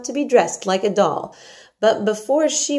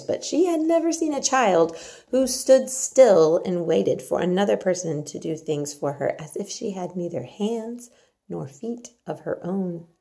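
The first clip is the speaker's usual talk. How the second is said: ordinary delivery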